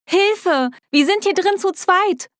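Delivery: high-pitched call for help; yelling for help. A narrator's reading. Trapped girl calls to the rescuer outside, yelling for help. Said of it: "brüllte ich".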